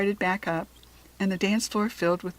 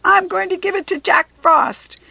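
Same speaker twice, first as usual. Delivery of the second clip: high, loud goblin cheer; gleeful goblin; 11 semitones higher, 10 dB louder than usual. A narrator's reading. Goblin running off with the apple, high gleeful cheer. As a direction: squeal it out as you run off.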